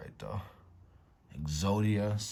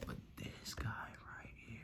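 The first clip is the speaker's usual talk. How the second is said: hushed suspense; 2 semitones higher, 16 dB quieter, slower than usual. Real, not acted. Building suspense before reveal.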